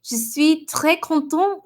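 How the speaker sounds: slow, careful French; reading viewer's French. Retold or imitated. Streamer reading a viewer's French comment, slow and careful. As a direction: sound out each French word slowly. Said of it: "You said".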